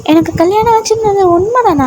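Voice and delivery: high, startled question; surprised heroine. Retold or imitated. The heroine, told she is married, asks in high surprise. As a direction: gasp at the news, pitch way up.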